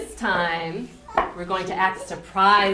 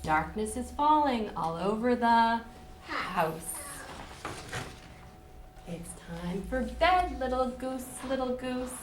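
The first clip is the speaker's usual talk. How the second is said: gentle sing-song bedtime read; reading to children; 4 semitones higher than usual. Acted. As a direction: lull them toward sleep. Reads bedtime book to children.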